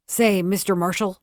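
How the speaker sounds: a gruff, mild growl at the marshal. A narrator's reading. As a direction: grumble it out, fed up. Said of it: "growled the glum-faced man".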